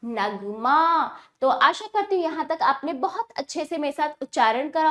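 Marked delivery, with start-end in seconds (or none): 0.1-1.2 Nagma with a long stretched आ, very slow; slow drawn-out demo. Shown as a demonstration. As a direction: draw out Nagma slowly for the children. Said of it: "ऐसे ही". (नगमा को खींचकर बहुत धीरे बोलना)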